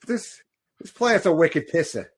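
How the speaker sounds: hammed-up Boston accent, high and loud; thick Boston accent, lifted pitch. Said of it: "Celestial with a Boston accent".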